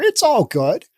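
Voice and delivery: high, breezy mock reassurance; mock carefree. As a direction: toss it off as if nothing matters. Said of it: "They're like".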